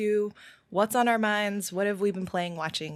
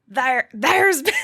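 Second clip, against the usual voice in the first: a slow, loud attempt at a pirate accent that breaks down; 4 semitones higher, 9 dB louder, slower than usual. Host tries the line in a pirate accent, slow and loud. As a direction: reach for a pirate accent and lose it to a laugh. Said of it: "I cannot do a pirate accent with that phrase".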